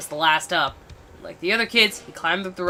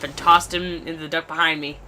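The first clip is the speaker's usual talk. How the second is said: ordinary delivery, nothing marked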